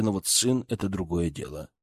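ordinary delivery, nothing marked

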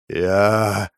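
very slow sick man's rasp from the grave; halting, drawn-out graveside croak; sepulchral rasp. A narrator's reading. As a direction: drag the words out like a dying man. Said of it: "захрипел загробным голосом больной".

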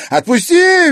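very high, loud shriek. A narrator's reading. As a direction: shriek to be let go. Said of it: "визжала Зверева".

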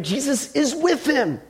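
high, drawn-out acted amazement; awed exclamation. Listener reacting to the empty chair story, awed.

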